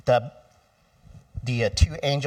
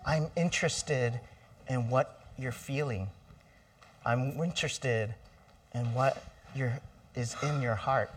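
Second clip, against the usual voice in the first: soft, tender reassurance; gentle interest; 7 dB quieter than usual. Acted. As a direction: say it quietly, as if comforting someone in tears. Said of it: "But more like".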